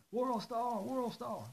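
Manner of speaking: high mocking chant; high, chanting like a bystander filming; mocking World Star shout. Imitated chant vs his own.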